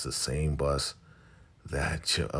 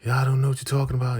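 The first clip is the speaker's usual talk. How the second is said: fast, loud, high imitation of the wife; 11 semitones higher, 7 dB louder, much faster than usual. Wife answering Brian in the torture chamber, high mock voice. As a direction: put on her high voice and rush it.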